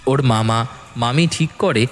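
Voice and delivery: plain reading voice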